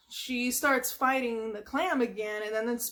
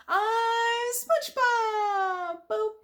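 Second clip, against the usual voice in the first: very high, slow drawn-out proud cry; proud shout; 11 semitones higher, 4 dB louder, much slower than usual. Retold or imitated. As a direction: announce yourself like a hero. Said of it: "he goes".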